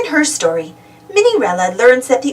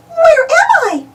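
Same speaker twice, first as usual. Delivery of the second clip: high, puzzled mouse voice; lost Minnie wondering aloud; 4 dB louder, slower than usual. Acted. Minnie waking somewhere strange, high puzzled voice. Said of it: "she wonders".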